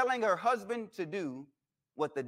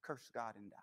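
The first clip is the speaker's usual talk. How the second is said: a hushed, much softer hiss of temptation; devil whispering; 9 semitones lower, 18 dB quieter than usual. Acted. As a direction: breathe it low like a tempter in the ear. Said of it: "the devil is whispering in his ear".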